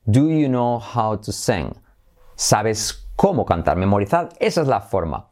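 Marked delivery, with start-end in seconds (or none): 0.0-1.7 slow, word by word; deliberately slow teaching pace. Shown as a demonstration. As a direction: spell out the structure slowly for learners. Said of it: "Lo digo despacio para que comprendáis".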